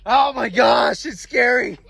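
hammed-up alarm at a ghost; acted fright. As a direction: play spooked and urgent to get away. Acted flight from a ghost horse.

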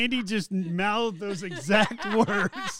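loud, drawn-out laughing tease. Real, not acted. Host teasing Andy about mouthing the exact words, laughing loudly.

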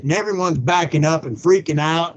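usual speaking voice

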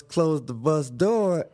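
ordinary delivery

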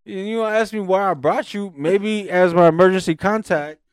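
high, loud, building exasperation; comic tirade, stretching the key words; indignant rant. Retold or imitated. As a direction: spell it out to your wife, outraged. Retold indignant rant at wife.

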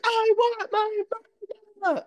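high, loud imitated wail; mock toddler crying. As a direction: imitate a small child crying for a parent. Mother voices child's crying line.

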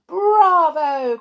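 high, loud cheer; witches cheering. A narrator's reading. Witches to their leader, loud cheer. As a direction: roar approval. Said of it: "cried the witches".

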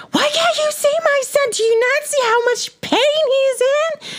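high shrill imitated screech at the staff; furious mother imitated. Mother yelling at dental staff, imitated high screech. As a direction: play the outraged mom shrieking at reception. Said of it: "she flipped her shit".

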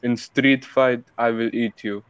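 put-on slow, deliberate Arabic-accented English; deliberate Arabic-accent challenge. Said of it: "It is Arabic".

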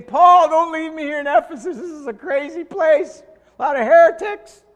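high, loud, desperate pleading; Timothy begging Paul, near tears; pleading young Timothy. Retold or imitated. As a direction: beg not to be left behind.

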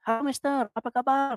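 high-pitched greeting, imitating a villager; friendly Indonesian local. Locals hailing a foreigner walking down the street, imitated high friendly call. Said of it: "people be like".

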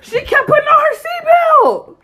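high, loud laughing shriek; squealing with disbelief and laughter; laughing outburst. Real, not acted. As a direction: shout it through real laughter. Laughing outburst while telling the story.

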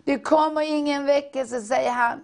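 prophetic preaching. Acted. Preacher speaking God's words to the congregation, fervent. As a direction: proclaim as God calling his people.

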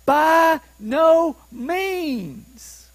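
each word of "By no means" drawn out high, very slowly; emphatic refusal. Acted. Preacher giving Paul's answer on injustice, hugely emphatic. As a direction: pound out BY, NO, MEANS one at a time. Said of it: "It's the most emphatic way of saying no".

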